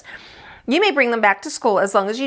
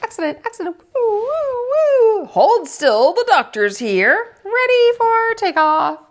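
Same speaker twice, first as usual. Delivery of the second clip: playful pretend; 11 semitones higher than usual. Lily playing pretend jobs.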